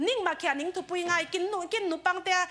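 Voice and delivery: usual speaking voice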